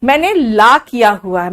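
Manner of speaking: ला with open aa, for "law"; open-mouthed aa on ला; Allahabad-style pronunciation. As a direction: say ला as "laa", mimicking regional speech. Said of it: "Allahabad वाले".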